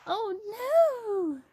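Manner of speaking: high, drawn-out mock dismay; gasp, then a high long cry of worry. Reader reacts to stuck truck, dismayed gasp.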